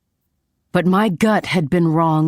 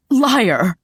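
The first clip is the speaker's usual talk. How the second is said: high, accusing cry, a touch of yelling; 6 semitones higher, 3 dB louder than usual. A narrator's reading. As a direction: fling the accusation at him. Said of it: "I yelled, my traitorous voice cracking".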